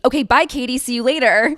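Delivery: happily brushing off her sister; excited goodbye. Retold or imitated. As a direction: toss it off gleefully as you leave. Ditching her sister for him.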